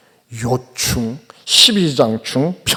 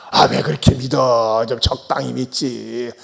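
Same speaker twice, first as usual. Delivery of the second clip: dismissive nagging; 7 semitones lower, 4 dB quieter than usual. Retold or imitated. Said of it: "하면서 말이죠".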